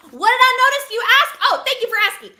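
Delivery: high, loud, theatrical self-questioning; mock audience question. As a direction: ask it for the listener, then play grateful. Plays an eager audience question, thanks them.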